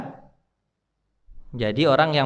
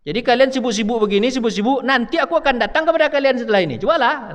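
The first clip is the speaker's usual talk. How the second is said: high, mocking voice of the angel; the angel warns the busy mourners; angel of death; 8 semitones higher than usual. (malaikat maut menyindir, suara tinggi)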